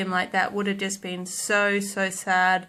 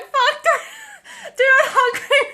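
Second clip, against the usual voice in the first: much higher, louder, cracking with laughter; laughing panic; 6 dB louder than usual. Retold or imitated. Dreading a hug from the dog's owner, high laughing shriek. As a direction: shriek it through giggles. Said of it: "and I'm like".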